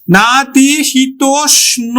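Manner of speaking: না, তি, শীতোষ্ণ slowly with a sh sound; slow demonstration of না, তি, শীতোষ্ণ; phonetic sounding-out. Shown as a demonstration. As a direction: stretch শীতোষ্ণ syllable by syllable. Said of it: "আমরা তালব্য স উচ্চারণ করি".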